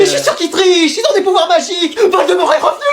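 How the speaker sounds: high-pitched frantic outburst; hysterical raving. Acted. Exam supervisor accusing twins of magic, hysterical. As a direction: rave in a high voice, hammed-up.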